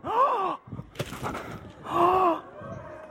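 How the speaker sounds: long, high pretend gasp; amazed gasp. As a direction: gasp theatrically at a new present. Acts amazement at second present.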